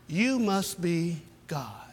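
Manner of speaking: slow, low, soft awe; adoring dog. Acted. Dog looking up at its owner, speaking in slow awe. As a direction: say it in wide-eyed devotion.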